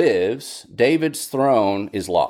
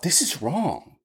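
higher-pitched, pleading protest; crying out in protest; 6 semitones higher than usual. Retold or imitated. Preacher voicing lament crying out, pitched up. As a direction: cry out against the wrongness. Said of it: "Lament cries out".

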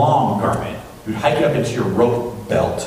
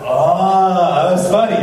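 higher, louder drawn-out reaction; mock amusement; 6 semitones higher, 5 dB louder, much slower than usual. Teenager reacting to the German student's reply, mock amusement. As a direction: act out getting the joke, pitched up.

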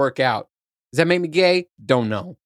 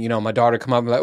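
normal talking voice

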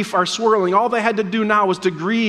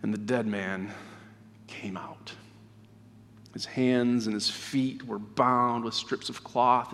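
solemn scripture reading; 8 semitones lower, 9 dB quieter than usual. A narrator's reading. Closing the gospel reading after the raising, hushed. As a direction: read softly with long, weighty pauses.

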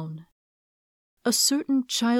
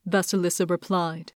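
ordinary delivery

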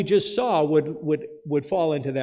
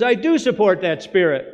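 quoting governor; 4 semitones higher, 8 dB louder than usual. Retold or imitated. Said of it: "she says".

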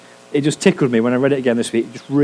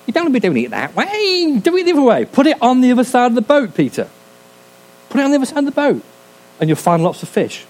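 very high, know-it-all busybody voice; hammed-up high-pitched know-it-all; Harry Enfield impression; 12 semitones higher, faster than usual. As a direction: interfere like a smug comic character. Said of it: "that Harry Enfield sketch".

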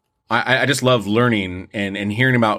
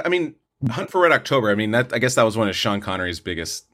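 ordinary delivery

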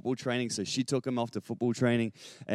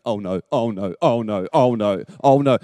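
mock-panicked kid, louder as it repeats; panicking son; 3 semitones lower, 7 dB louder than usual. Son runs downstairs after flooding the bath, panicking. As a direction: rattle off a child's mounting alarm. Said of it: "all I hear is Rocky going".

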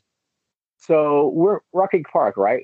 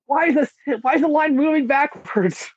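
high-pitched comic complaint; exasperated mock wail; 11 semitones higher than usual. Runner recalls hitting the long stretch, in high mock despair. Voicing own plight on hard stretch.

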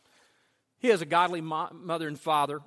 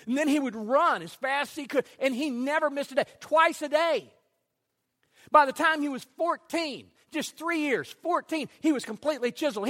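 high, loud, driving sermon climax; full force preaching; 10 semitones higher, 8 dB louder than usual. Acted. Pastor telling the congregation Herschel's story, preaching at full force. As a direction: preach it to the rafters, building.

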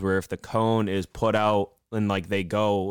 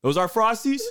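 high, astonished question; mock surprise; 13 semitones higher than usual. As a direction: squeak it out in disbelief. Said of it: "And he goes".

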